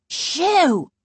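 high, drawn-out shooing cry; high-pitched yell to scare it off. A narrator's reading. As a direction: shoo an animal away. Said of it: "Casey yelled at the squirrel".

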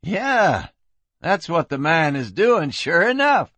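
high, jeering agreement; mocking. A narrator's reading. Flynn goads McCrow against the lawman, mocking. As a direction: needle him with a mocking lilt. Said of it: "Curly Flynn's mocking laugh".

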